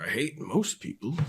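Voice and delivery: low, soft, dark monologue delivery; a hint of a brooding film villain, dropped quiet; Daniel Plainview. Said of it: "really weird, dark Daniel Day-Lewis monologues".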